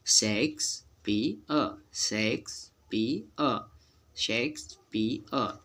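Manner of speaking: शेक्सपियर very slowly, शेक्स-पी-अ; three spaced beats in शेक्सपियर, no final r; correct silent-r pronunciation. Shown as a demonstration. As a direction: sound out शेक्सपियर ending on अ. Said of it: "अ sound वहाँ दे जाता है silent होके".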